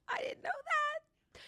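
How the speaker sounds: tiny, high, slow whimper; mock-hurt squeak. Telling interviewers she had no idea, mock-hurt squeak. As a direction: squeak it out in mock distress after a laugh.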